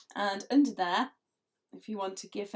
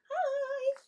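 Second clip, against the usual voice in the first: high, cheery greeting; a chirpy hello from a smiling old man; happy old man. Acted. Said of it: "He'd be going".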